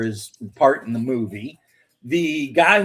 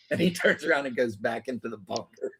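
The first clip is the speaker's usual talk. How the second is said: ordinary talk, nothing marked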